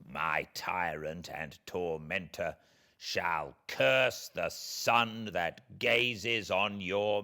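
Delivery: the wronged creature, bitter and threatening, slow on the warnings; vengeful creature. Acted. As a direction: speak as a spurned monster promising revenge. Creature threatens his maker.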